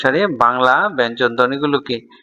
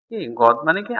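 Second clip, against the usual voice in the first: usual speaking voice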